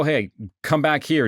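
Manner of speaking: normal talking voice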